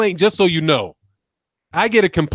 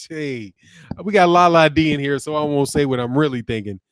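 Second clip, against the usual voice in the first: ordinary talk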